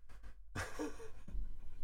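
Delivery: silent breathy chuckle; amused airy laugh. Real, not acted. Laughs at own joke.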